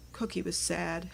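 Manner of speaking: plain reading voice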